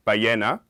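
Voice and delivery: ballena with the beat on LLE; Spanish "ballena", second syllable stressed. Shown as a demonstration. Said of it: "stress on the second syllable".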